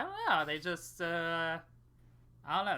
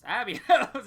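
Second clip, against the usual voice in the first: higher, louder, laughing through the word knows; laughing mock realization; 6 semitones higher, 8 dB louder than usual. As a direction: crack up on knows. Mocking the game characters.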